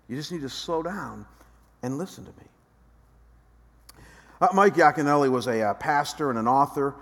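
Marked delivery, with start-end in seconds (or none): 0.1-2.5 gentle God voice. Acted. God speaking softly continues.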